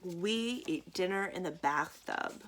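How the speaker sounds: plain reading voice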